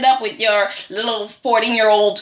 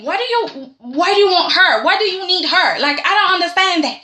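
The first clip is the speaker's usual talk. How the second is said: pissed; 6 semitones higher than usual. Retold or imitated. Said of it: "he's pissed".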